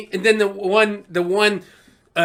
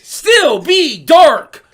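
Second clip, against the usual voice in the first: loud, slow, high-pitched insistence; exasperated fan making the case; emphatic ranting; 11 semitones higher, 10 dB louder, much slower than usual. Real, not acted. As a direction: pound each word out slowly and loudly. Heated argument to defend Luke.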